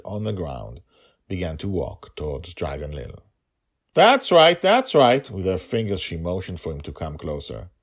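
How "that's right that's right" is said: high, loud goading; taunting call. A narrator's reading. Girl goads the monster closer, loud taunt. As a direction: beckon him in cockily.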